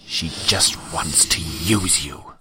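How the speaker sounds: very slow, other-voice warning; sly inner voice. Acted. Leech warning Damien inside his head, slow and sly. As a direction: drip the suspicion slowly.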